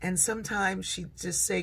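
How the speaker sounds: ordinary delivery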